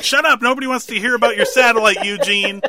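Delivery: high, loud, fast shouted dismissal, laughing through it; hammed-up exasperated yell at Eugene; mock shout. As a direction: yell him down while half laughing. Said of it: "they're just like".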